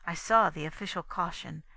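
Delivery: usual speaking voice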